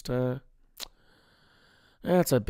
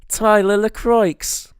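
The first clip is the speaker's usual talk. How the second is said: put-on high, loud, drawn-out New Zealand accent; Benny; 7 semitones higher, 9 dB louder, much slower than usual. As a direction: stretch the name out in an imitated Kiwi accent. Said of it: "trying to think of how fucking Benny says my name".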